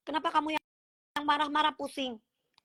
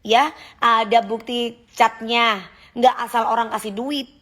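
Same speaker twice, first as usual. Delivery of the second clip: ordinary talk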